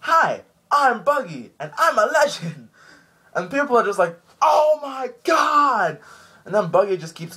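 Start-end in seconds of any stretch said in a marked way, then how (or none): 0.0-2.7 loud, high, drawn-out showboating voice; Buggy. Retold or imitated. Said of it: "He goes like this". 4.4-6.0 very high, long-drawn mock shout; crowd's shout. Crowd reacting to Buggy.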